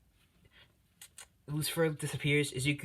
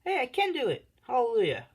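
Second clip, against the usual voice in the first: high, loud triumphant whoop; excited cheer; 11 semitones higher, 6 dB louder than usual. Real, not acted. Youtuber cheers after finally getting it to work. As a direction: celebrate like you just won. Finally managed to post comment.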